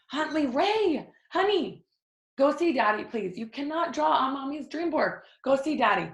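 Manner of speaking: high, sing-out parental scolding. Real, not acted. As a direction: shoo a little one away, voice pitched well up. Mother shooing child off her dream board.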